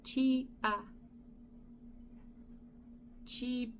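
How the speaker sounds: Chibiabos tried slowly, syllable by syllable, then repeated and fading; practising a name. Shown as a demonstration. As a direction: sound out Chibiabos piece by piece till it sticks. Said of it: "I looked it up, how to read it".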